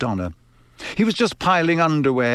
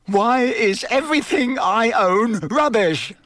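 higher, slow, furious outburst; 10 semitones higher than usual. Acted. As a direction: spit it out in full fury at his junk. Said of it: "said Ron furiously".

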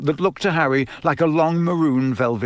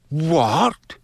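horrified; 4 semitones higher than usual. A narrator's reading. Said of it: "looking horror-struck".

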